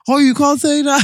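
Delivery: high, laughing mock scold; mock scolding friends. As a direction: squeal it out like shocked friends. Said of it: "you lot are always like".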